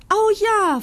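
hohes, lautes Oh ja; freudig. A narrator's reading. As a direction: vor Freude aufjauchzen. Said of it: "freut sich Locke". (freudiger Ausruf)

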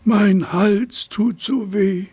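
high, slow, drawn-out whimper of a sore giraffe; whiny giraffe. A narrator's reading. Giraffe complaining to herself about her sore neck, whining. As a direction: moan it like a self-pitying giraffe. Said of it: "Sie jammerte vor sich hin".

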